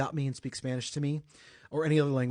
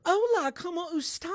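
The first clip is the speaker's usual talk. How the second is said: put-on Southern-accented Spanish; 4 dB louder than usual. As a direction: imitate a teacher's Southern-accented Spanish, pitched way up. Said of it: "She had a southern accent".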